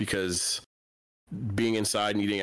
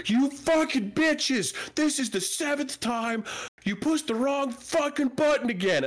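furious yelling; 13 semitones higher, slower than usual. Retold or imitated. Fed-up worker yelling back at the order takers, furious yell. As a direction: yell it like a worker at the end of his rope. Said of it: "I'm sorry for yelling".

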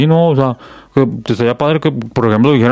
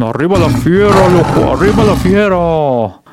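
team cheer; 6 semitones higher than usual. Real, not acted. Cheering his team León.

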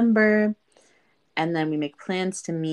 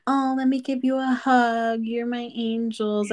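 higher, syrupy mock-grateful voice; sugary imitation of the scammer thanking them; mock sweet scammer; 6 semitones higher than usual. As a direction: parody his fake gratitude. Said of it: "pretended to be all like".

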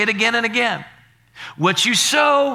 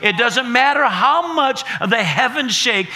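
ordinary talk

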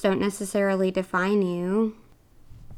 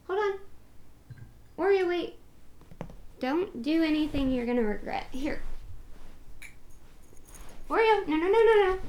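higher pet-talk voice, urging the cat to stop; coaxing the cat; 7 semitones higher, 7 dB quieter than usual. Real, not acted. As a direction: call the cat off, voice up high. Calling the cat off the bed.

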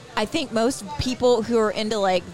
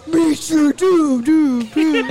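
slow, louder, looming doom call; booming giant voice; 4 semitones higher, 6 dB louder, much slower than usual. Retold or imitated. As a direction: boom it out like a giant. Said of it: "then you just hear".

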